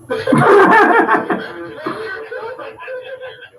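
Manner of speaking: long, loud burst of laughter; hearty laugh. Real, not acted. Co-host cracks up at the rectum joke, hearty laughing.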